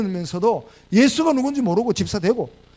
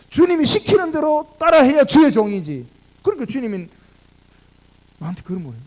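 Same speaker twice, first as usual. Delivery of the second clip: ordinary talk, nothing marked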